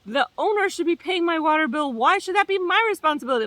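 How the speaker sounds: high indignant mock whine; mad tenant. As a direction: complain it up high, outraged. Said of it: "five minutes of, like".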